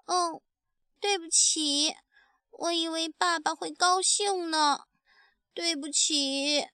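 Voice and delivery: put-on high, small child's voice, close to crying; tearful apology. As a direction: apologise to daddy on the verge of tears. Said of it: "不要哭了". (小恐龙委屈地哭着道歉)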